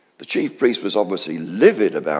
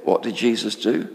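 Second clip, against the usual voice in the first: ordinary delivery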